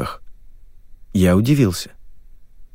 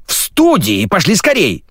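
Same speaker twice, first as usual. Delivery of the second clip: higher-pitched, urgent order; commanding; 9 semitones higher than usual. A narrator's reading. Lesya orders the lady to the studio, urgently. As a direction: hurry them along briskly. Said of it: "велела Леся".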